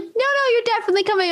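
high-pitched, insistent reassurance; retold reassurance. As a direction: jump up high to reassure a worried friend. Retold hasty reassurance to worried friend.

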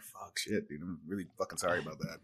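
muttered apology. Retold or imitated. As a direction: mumble it like you know you overdid it. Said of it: "I'm like".

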